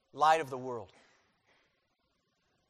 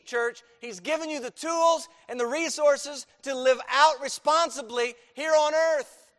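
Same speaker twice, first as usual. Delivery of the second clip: loud, high preaching, hammering each phrase; full-force preaching; 9 semitones higher, 10 dB louder than usual. Acted. Pastor drives the point home to his church, loud full-force preaching. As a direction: preach it hard to the congregation. Exhorting the church on their calling.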